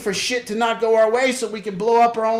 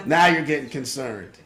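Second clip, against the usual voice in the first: normal talking voice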